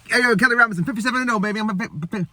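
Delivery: high, cocky hype-man voice; boxer trash talk. Retold or imitated. Boxer hyping his record on screen, high cocky voice. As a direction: brag like a showboating boxer.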